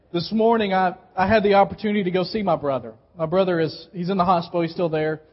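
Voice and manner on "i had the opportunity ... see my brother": normal talking voice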